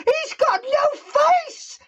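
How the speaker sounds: shrieking very high with laughter; terrified scream. Retold or imitated. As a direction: scream it like a terrified old char lady. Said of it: "she gets terrified. She goes".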